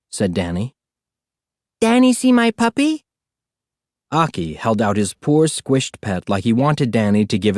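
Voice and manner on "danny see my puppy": put-on high eager toddler question. A narrator's reading. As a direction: show off the pet like a proud toddler. Toddler Aki shows his pet.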